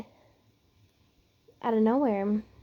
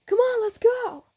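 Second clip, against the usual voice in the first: high-pitched, pushy urging; impatient brash fighter; 12 semitones higher, 3 dB louder than usual. Acted. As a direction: urge them on in a high voice. Impatient partner urging her on.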